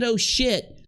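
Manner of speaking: high mock "Oh, shit" in alarm; imitating a shocked outburst. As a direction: act out her startled realisation. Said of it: "She said".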